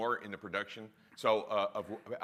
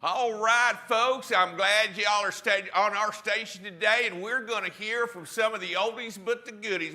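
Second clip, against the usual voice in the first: country DJ voice; 7 semitones higher, 4 dB louder than usual. Pretend. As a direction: ham it up like a country disc jockey introducing oldies. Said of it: "in your country D J voice".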